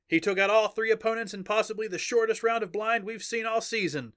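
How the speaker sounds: high-pitched triumphant arena commentary; excited announcer. Acted. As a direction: call the winning blow to the crowd. Announcer calls the match.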